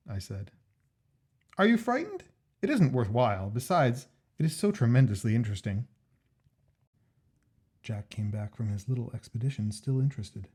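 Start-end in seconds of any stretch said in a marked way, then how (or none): 1.6-5.9 Jack's voice. Acted. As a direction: voice the keen friend Jack, pitched up. Jack's voice in story.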